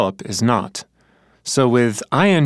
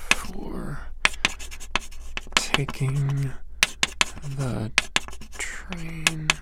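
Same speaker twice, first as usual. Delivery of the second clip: slow, much softer word-by-word dictation; writing aloud; 2 semitones higher, 11 dB quieter, much slower than usual. Shown as a demonstration. [name] writes Marta's sentence, dictating quietly to himself. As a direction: spell it out under his breath as he writes. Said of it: "Write this one, too".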